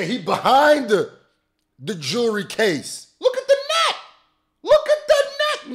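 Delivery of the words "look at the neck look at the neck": excited exclaim. Real, not acted.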